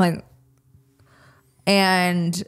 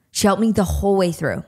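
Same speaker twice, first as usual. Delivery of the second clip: ordinary delivery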